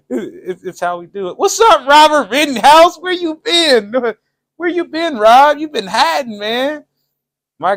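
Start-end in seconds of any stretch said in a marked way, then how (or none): 1.4-6.8 high, loud, excited greeting; excited shout-out. Real, not acted. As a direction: hype up a returning fan.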